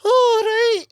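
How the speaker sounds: high, loud bad Scottish accent; squawked fake Scottish accent; mock Scottish accent. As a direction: attempt a Scottish mom's voice, pitched high. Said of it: "in my Scottish mom's accent".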